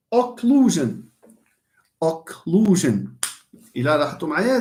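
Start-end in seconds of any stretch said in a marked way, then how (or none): 0.1-3.2 "Occlusion" with a strong middle LU; stress on LU. Shown as a demonstration. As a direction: stretch and punch the LU in "Occlusion". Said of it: "stress على لو".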